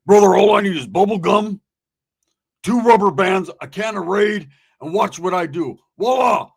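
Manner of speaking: loud, showman-like claim of a makeshift inventor; boastful retold brag. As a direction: announce it like a big reveal, loudly. Acts out Roach's boast.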